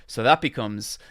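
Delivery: normal talking voice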